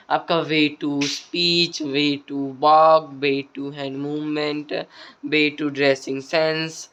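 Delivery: very slow, drawn-out list. Shown as a demonstration. Teacher listing public speaking habits to viewers, very slow. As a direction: spell out each item slowly.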